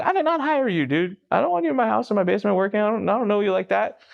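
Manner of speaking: high-pitched, exasperated refusal; indignant protest. Retold or imitated. Refusing to let an odd friend work in his basement, indignant. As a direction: act out pushing back hard, pitch up.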